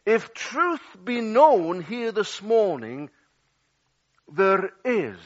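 ordinary delivery

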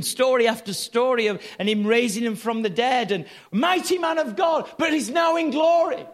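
high, exclaiming preacher; impassioned preaching. Real, not acted. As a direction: marvel aloud at each exploit. Preaches on the healer's exploits.

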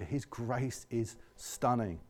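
slow, soft and hushed; soft awed refrain. Real, not acted. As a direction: let the words sink in quietly.

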